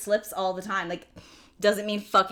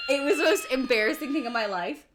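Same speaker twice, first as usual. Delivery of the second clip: normal talking voice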